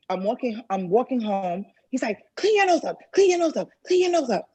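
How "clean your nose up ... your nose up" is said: high-pitched, insistent mimicry; imitated harsh order. Attacker ordering her on the walk home, high mimicked command.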